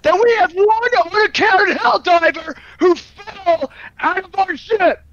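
roleplayed officer chewing out his crew; angry commanding officer. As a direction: berate the cadet like a furious superior. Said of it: "I put a lot of character into that".

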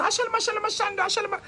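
high-pitched repetitive gibberish; hammed-up church babble, high; rolling in fake tongues. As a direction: keep up the mock-ecstatic tongues while rolling on the floor. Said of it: "And I roll and I go".

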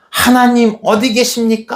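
high-pitched, indignant demand; throwing a temper. Retold or imitated. Elisha as Israel's salvation disappears, acted temper. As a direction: act out railing at God. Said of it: "막 승질 부리면서".

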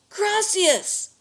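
high, loud alarmed call; alarmed yell. A narrator's reading. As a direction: call out for a lost pet. Boy calls out for stolen turkey.

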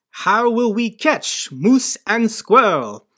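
slow, hammed-up Russian villain accent, pitched high; big theatrical fake Russian accent, drawn out; cartoon Russian accent. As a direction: do a Rocky and Bullwinkle style Russian accent. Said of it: "She really should have sounded like this".

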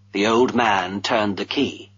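usual speaking voice

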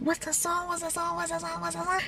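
high, hushed repeated question; mimicked pestering. Asking homegirl for the song, hushed high pestering. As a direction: chant it softly and rapidly up high.

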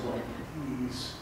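soft begging to keep her quiet; a kid softly begging his sister to stop crying; pleading hush. Retold or imitated. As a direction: plead under your breath with the sister.

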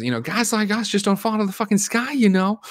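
high yelled freak-out; screaming. Retold or imitated. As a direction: scream it at her, losing it. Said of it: "starts screaming at her".

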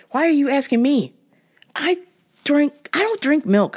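fed up, throw the question back; exasperated. Retold or imitated. Exasperated retold reply.

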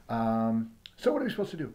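ordinary talk

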